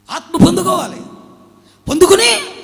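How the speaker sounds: loud, high, ringing proclamation; shouted preaching. Acted. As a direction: belt it out to the congregation. Preacher repeats line, shouting it.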